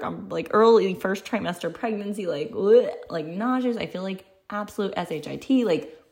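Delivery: ordinary delivery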